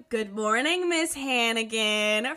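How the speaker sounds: "Good morning" rising, "Miss Hannigan" slow and drawn out; drawn-out singsong greeting. Retold or imitated. Orphans in Annie greeting the matron, "Miss Hannigan" drawn out in singsong. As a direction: chant "Miss Hannigan" slowly like a dutiful chorus. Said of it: "the same tone".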